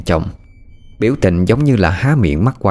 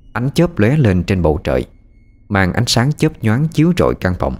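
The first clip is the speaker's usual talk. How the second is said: usual speaking voice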